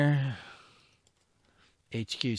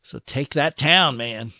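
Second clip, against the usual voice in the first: louder and higher, pushing; urging the troops on; 3 semitones higher, 9 dB louder than usual. Real, not acted. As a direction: take that town, loud and pushing. Urging units on.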